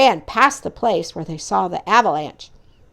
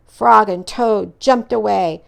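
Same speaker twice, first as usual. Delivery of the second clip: plain reading voice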